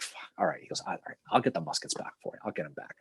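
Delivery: hushed, grudging half-whisper; muttered whisper. Retold or imitated. Humphreys caving to the militia head, whispered. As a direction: give in under your breath, beaten. Humphreys caught giving away muskets.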